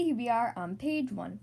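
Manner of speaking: ordinary delivery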